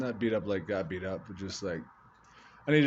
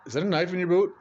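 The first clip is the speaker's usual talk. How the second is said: high, loud startled cop question; surprised cop; 8 semitones higher, 5 dB louder, faster than usual. Retold or imitated. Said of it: "He's like".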